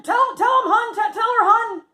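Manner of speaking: loud, high, insistent urging; urgent prodding. Retold or imitated. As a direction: mimic a friend egging on her partner. Friend urges partner to tell it.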